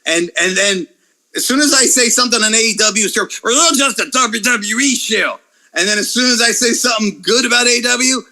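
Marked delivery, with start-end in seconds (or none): 3.4-5.4 higher, put-on fanboy whine; mocking angry fan. Mimicking angry wrestling fans.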